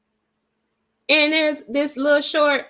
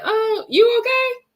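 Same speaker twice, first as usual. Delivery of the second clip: much higher, gentle "you okay?"; caring check-in; 11 semitones higher, slower than usual. Pretend. A loving stranger checking on a flustered mom, gentle. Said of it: "come to me and say".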